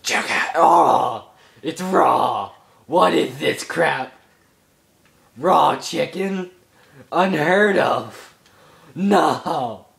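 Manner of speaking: loud, indignant shouting at the food; outraged yelling. Real, not acted. Gamer yelling at raw chicken in the fridge, outraged. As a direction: yell in disbelief at raw chicken.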